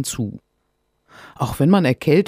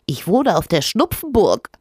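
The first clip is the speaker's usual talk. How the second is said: higher, louder sprite voice; little creature voice; 6 semitones higher, 4 dB louder than usual. Acted. Rinus tells Sophia where he lives, in a high little creature voice. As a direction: pipe it like a tiny cheerful creature.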